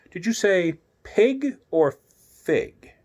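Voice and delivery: a playful cat toying with her; Cheshire Cat. Acted. Cheshire Cat voiced by reader.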